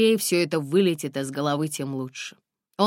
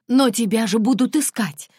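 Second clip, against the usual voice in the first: louder, alarmed cry; exclaimed; 6 semitones higher, 6 dB louder than usual. A narrator's reading. Driver alarmed the girl will be hunted, crying out. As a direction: blurt it out in alarm. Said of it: "вскрикнул".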